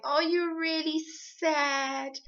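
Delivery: high, drawn-out sing-song with a smile; slow, sugary pity through a big smile; mock smiling sympathy. Shown as a demonstration. Coach showing parents how not to respond, falsely cheerful sympathy. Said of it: "You're not smiling and saying".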